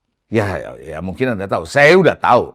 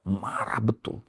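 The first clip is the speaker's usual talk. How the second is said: whispered aside; 10 dB quieter, slower than usual. Real, not acted. Aside about the angry businessman.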